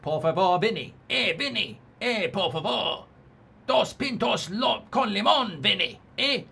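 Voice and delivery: high, put-on Spanish accent. Streamer doing a requested shout-out in a mock Spanish accent. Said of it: "do my shout-out in a Spanish accent".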